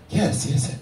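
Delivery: usual speaking voice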